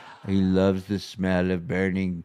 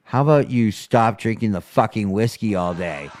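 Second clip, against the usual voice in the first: ordinary talk, nothing marked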